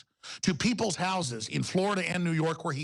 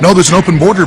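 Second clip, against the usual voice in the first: ordinary delivery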